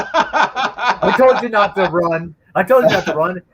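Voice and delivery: high, slow nagging dad; scolding dad. Retold or imitated. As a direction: lecture the kid. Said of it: "my dad's like".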